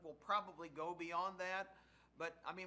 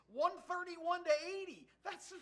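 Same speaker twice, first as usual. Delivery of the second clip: amazed; 11 semitones higher, 6 dB louder than usual. Real, not acted. Amazed by the blowout score.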